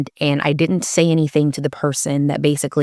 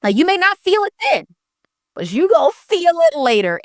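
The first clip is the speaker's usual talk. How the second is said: much higher, sing-song sassy warning; sassy taunt; 11 semitones higher than usual. Real, not acted. Warning people who cross her, high and sassy. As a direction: tease them with a knowing high lilt.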